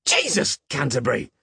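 high, loud yelp of shock; startled yelp. A narrator's reading. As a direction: yelp as if made to jump. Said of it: "Peg yelped".

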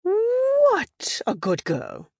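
high, slow praise with a drawn-out "What"; high-pitched slow "What a good girl", falling away; softened British trainer praise. Retold or imitated. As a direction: coo to a dog as a British lady, stretching "What". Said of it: "consonant on the other side ... got drawn out".

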